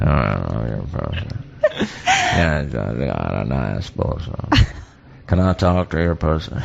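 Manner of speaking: very low, slow, slurred phone creep; deep, murky creepy voice; creepy caller. Acted. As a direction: mumble it way down low. Playing the creepy prank caller.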